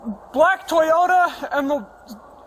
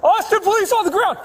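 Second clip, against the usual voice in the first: shouted surrender demands; 6 semitones higher than usual. Real, not acted. Officer confronting armed suspect.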